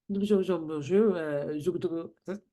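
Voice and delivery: repeating bonjour in a light fake French accent; imitating a man's halting French; mock French accent. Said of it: "just my impression of him speaking French".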